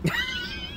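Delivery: squeaky high laugh; high giggle. Real, not acted. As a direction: let out a tiny high giggle.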